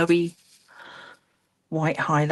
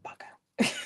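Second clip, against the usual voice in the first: muttered under the breath; whispered curse; 6 dB quieter than usual. Real, not acted. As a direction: whisper the swear to yourself. Swearing under her breath.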